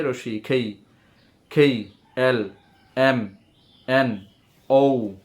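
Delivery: ordinary delivery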